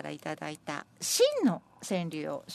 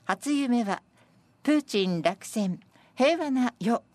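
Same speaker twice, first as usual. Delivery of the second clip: loud, raised, spaced verse reading; senryu recitation; 6 semitones higher, 5 dB louder, slower than usual. Acted. Presenter reading senryu dreaming of world peace, projected recitation. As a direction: declaim each phrase out to the room.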